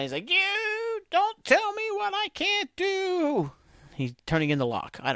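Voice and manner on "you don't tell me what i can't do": high, slow, hammed-up angry voice; mock angry outburst. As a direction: squeal it out slowly in mock rage. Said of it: "he gets mad".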